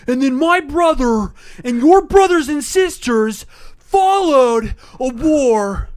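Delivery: loud, high, slow mock drama; dramatic [name]. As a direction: overact it like a rock singer. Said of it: "he's like ... so dramatic with it".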